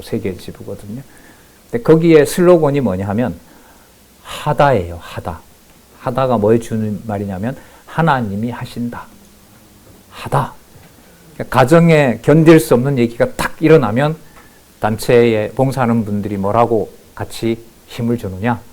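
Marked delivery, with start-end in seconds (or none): none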